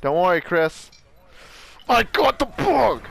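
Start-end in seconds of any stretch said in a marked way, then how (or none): 1.9-3.0 excited shout. Real, not acted. Triumphant game callout.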